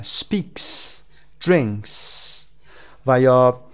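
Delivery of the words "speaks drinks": "Speaks", "drinks" ending in a long voiceless S; final S sound. Shown as a demonstration. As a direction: let the S hiss at the end of "Speaks" and "drinks". («Speaks» و «drinks» با «س» کشیده در انتها)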